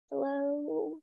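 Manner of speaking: a small, shy hello into the phone; little voice. Retold or imitated. Caller greets Namjoon on the phone in a tiny soft voice. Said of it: "this little voice when he called in".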